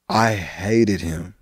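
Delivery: slow, deliberate, low-pitched dislike; emphatic loathing. Real, not acted. Venting about a disliked teacher.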